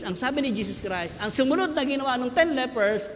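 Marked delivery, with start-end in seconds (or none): none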